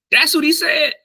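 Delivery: very high, incredulous question; mock disbelief. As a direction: imitate a friend amazed at the translation. Acts out friend amazed at translation.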